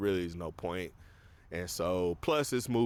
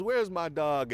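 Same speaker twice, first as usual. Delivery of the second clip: put-on high, demanding girl voice; whiny teen calling; Supergirl voice; 7 semitones higher than usual. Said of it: "and she goes".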